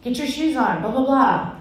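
impatient mom hustling out the door; hurried parent. Retold or imitated. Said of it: "I'm trying to get out the door".

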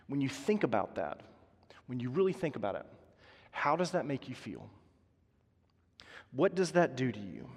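hushed, unvoiced question to the congregation; a hushed invitation to think; whispered reflection. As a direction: lean in and whisper it.

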